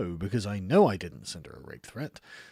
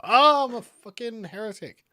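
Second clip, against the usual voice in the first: hammed-up shocked cry, much higher and louder; mock horror; 11 semitones higher, 8 dB louder than usual. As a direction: play the outraged heretic for laughs. Mock self-accusation, hammed up.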